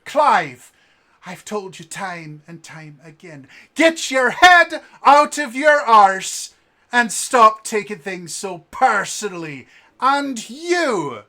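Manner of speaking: loud, high, exasperated rant; angry lecture to a hothead; scolding innkeeper. Acted. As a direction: chew him out like a fed-up boss.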